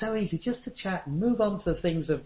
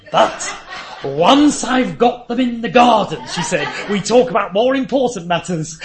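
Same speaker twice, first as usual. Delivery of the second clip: louder, laughing through the punchline; 6 semitones higher, 8 dB louder than usual. Real, not acted. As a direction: chuckle as you deliver the twist. Laughing while retelling the punchline.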